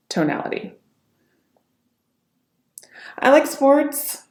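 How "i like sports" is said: slow, very high-pitched fright; fearful. Shown as a demonstration. Coach demonstrating fearful tonality, afraid. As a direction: raise the voice high as if scared. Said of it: "Fearful tonality".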